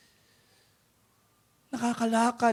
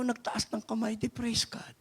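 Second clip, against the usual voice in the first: whispered praise; 8 dB quieter than usual. Acted. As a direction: drop into a voiceless whisper. Softly tells of the praise.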